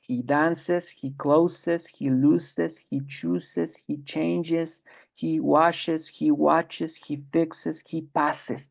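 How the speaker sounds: he dances etc. with an added syllable es on each verb; extra syllable es. Shown as a demonstration. Teacher showing third person endings to students, added es syllable. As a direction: give every verb a clear extra "es" syllable. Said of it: "tengo que añadir la sílaba es".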